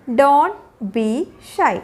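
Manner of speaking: slow, each word set apart. Shown as a demonstration. Teacher showing learners what to tell shy children, word by word. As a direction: pause after "don't" and after "be". Teacher models phrase slowly, then joined.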